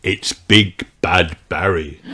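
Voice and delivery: plain reading voice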